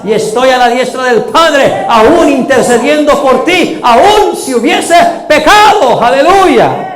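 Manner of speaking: impassioned preaching. Acted. Preacher proclaims Christ's intercession to the faithful, building to a shout.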